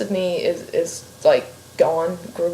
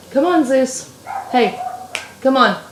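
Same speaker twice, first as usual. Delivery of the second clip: calling the dog; 5 semitones higher than usual. Real, not acted. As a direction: coax the dog away from the door. Calling dog away from door.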